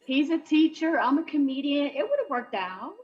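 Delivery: ordinary talk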